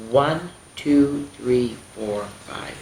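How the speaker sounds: plain reading voice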